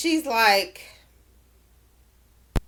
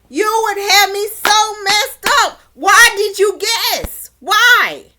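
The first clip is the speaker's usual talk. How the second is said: loud, high-pitched exasperation; indignant outburst; 8 semitones higher, 7 dB louder, slower than usual. Pretend. Recapper scolding Shane for guessing, loud and indignant. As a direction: demand an answer as if you were the one wronged.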